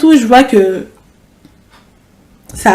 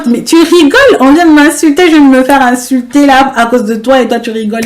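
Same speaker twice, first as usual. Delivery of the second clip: higher, louder exasperated protest; indignant outburst; 5 semitones higher, 5 dB louder than usual. Retold or imitated. Narrator snapping at her cousin who laughs, indignant. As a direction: scold her for laughing at you.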